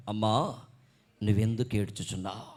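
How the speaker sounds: low-pitched, understated question; voice from behind. Acted. The voice Mary hears behind her as she weeps, low and restrained. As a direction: ask it in a deep, restrained voice. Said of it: "ఏమిటంటే ఆ స్వరం".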